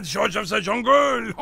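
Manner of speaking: hammed-up French accent, slow and loud. Host shows the guest the French way, mock French accent. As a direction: belt it out like a comic Frenchman. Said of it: "You have to try and say it like a, uh, like a French person".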